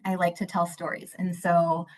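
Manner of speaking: normal talking voice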